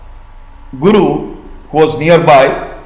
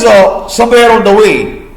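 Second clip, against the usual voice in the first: ordinary delivery, nothing marked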